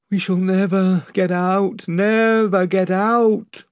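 drawn out, despairing moan; the rowers lose hope. A narrator's reading. The rowers moan in despair at the oars, slow and hopeless. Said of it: "moaned the rowers".

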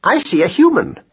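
high, precise pronouncement; formal observation, pitched well up; pedantic scholar mouse. A narrator's reading. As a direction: announce it like a learned little mouse. Voices Marcus Aurelius.

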